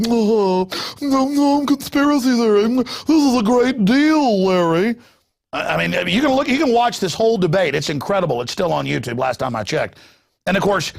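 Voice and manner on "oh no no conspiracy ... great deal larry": high, breathy-free mock dismissive voice, slowing down; mocking Al Gore. Al Gore waving off Perot to Larry King, high mocking voice. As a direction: sneer out a higher prissy brush-off. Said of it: "Al Gore and his whole effeminate thing's like".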